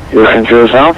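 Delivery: ordinary talk, nothing marked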